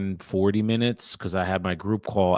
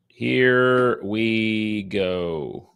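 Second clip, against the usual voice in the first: drawn out; much slower than usual. Real, not acted. Drawn-out repeat.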